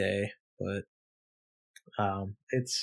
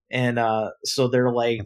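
ordinary talk

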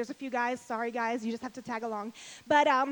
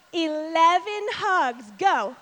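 slow, loud, drawn-out call to action; rally the room to go hug; emphatic slow command; 5 dB louder, much slower than usual. Real, not acted. Speaker urging the audience to start hugging, slow and loud. Sends crowd to hug people.

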